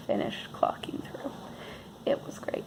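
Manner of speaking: sarcastic whisper; dry whisper. Real, not acted. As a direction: mutter it wryly.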